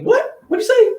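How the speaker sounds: high startled what'd you say from Jesus; mock-baffled Jesus voice. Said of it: "Jesus like".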